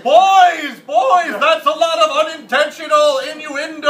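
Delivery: put-on a grand sage gently chiding the boys; imitating Carl Sagan. Carl Sagan chiding the boys about innuendo, mock sage voice. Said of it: "Carl Sagan's like".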